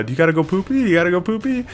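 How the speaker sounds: high, sing-song poopy question; dad's high baby-talk question. Retold or imitated. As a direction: coax a toddler in baby talk. Dad asking toddler.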